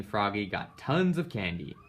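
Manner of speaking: ordinary delivery, nothing marked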